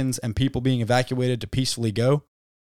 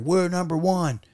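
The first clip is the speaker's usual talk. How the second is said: mock boast; 5 semitones higher, slower than usual. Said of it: "Daniel Tosh skit where he's like".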